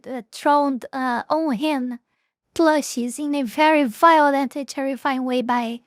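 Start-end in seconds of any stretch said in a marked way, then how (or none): 5.4-5.9 breaking into laughter mid-sentence; can't get the words out for laughing. Real, not acted. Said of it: "I cannot even say that without laughing".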